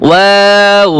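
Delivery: ওয়াও held long, closing on rounded lips; slow, sustained ওয়াও; drawn-out waw. Shown as a demonstration. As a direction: stretch the middle of ওয়াও, then round off. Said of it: "ভেঙ্গে ভেঙ্গে লিখি তাহলে".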